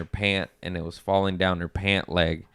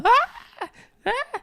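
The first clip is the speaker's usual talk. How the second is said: very high, short panicked shrieks; imitated scream; 3 dB louder than usual. Aunt kicking at a supposed bug, mock screams. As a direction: mimic a startled aunt screaming. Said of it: "screaming, going".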